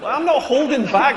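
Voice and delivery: ordinary talk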